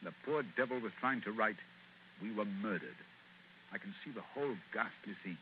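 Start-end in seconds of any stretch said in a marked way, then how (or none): 2.2-5.4 lower, softer and grave; grim hush. Acted. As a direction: let the horror sink in. Holmes reconstructs the murder.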